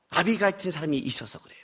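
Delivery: soft, whispery reveal; hushed answer. Real, not acted. Preacher answering his own question about David, hushed. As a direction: drop to a near whisper.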